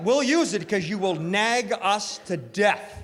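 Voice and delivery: ordinary talk, nothing marked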